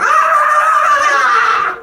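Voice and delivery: long, very high mock shriek; sustained piercing party scream; imitated group scream. Bachelorette party walking into a bar, shrieking imitation. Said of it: "'Cause you be screaming so much".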